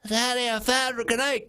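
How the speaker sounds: put-on high-pitched little kid voice; little boy. Nine-year-old runs up to dad, little boy voice. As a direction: call out in a high child's voice. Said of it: "and he runs up".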